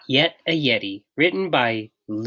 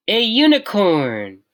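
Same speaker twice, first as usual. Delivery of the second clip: proud announcement; 8 semitones higher, 6 dB louder than usual. A narrator's reading. George's bold wish.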